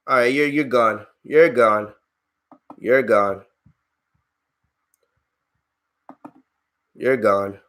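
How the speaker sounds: low-pitched, matter-of-fact verdict; low, final dismissal. Real, not acted. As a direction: drop the voice and dismiss them for good. Streamer bans a racist chatter.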